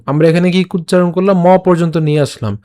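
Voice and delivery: normal talking voice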